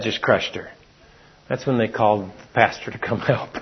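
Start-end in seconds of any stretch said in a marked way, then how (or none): none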